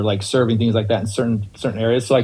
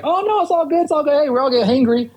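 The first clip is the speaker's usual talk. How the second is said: much higher, brushing it off; breezy reassurance; 12 semitones higher, faster than usual. Retold or imitated. Bartender reassuring an apologetic guest, much higher and breezy. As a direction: put the guest at ease.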